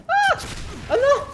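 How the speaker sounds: loud, high yelp, then a dismayed oh no; real cry of dismay. Real, not acted. Gamer gets hit by the boss and cries out loudly.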